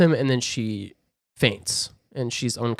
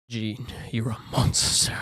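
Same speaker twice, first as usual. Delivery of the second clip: hushed, horrified whisper; 3 dB quieter than usual. Retold or imitated. Eric whispering in horror to Jean. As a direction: breathe it out in shock. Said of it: "Eric is like".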